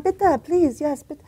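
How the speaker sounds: hammed-up exasperated mother. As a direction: set the grandfather straight, exasperated. Mother explaining German word.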